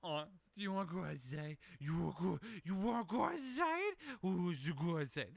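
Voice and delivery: put-on high, sing-song baby talk to a pet; imitating a pet owner's rising dog-talk voice. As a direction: coo at the dog like it's a toddler. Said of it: "if you have a dog and it's like".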